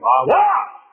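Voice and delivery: high, loud preacher's yell; shout from the grave. Acted. Preacher voices dead gods in the grave, high shout. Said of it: "have to shout from the grave".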